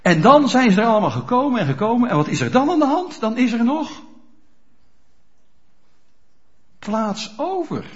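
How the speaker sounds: building fast and loud, then slowing on plaats over; rising preaching. Acted. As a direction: preach it with mounting urgency, then hold back. Preaching at full force.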